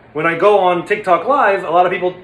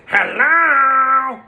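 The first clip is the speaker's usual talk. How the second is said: higher, louder nasal-sounding hello; Stanley voice; 8 semitones higher, 5 dB louder than usual. Shown as a demonstration. Stanley's trigger word, a high bright hello. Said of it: "For Stanley, it's".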